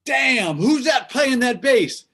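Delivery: high, loud amazed star impression. Singer hearing the bass player, amazed high impression. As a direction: exclaim in high voice, stretching the words. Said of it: "That's my [name] impression".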